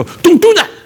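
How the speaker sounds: loud, high, abrupt scolding; high-pitched mock parental shout; emphatic parent. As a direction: scold like a parent giving no reason. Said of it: "And emphatic face".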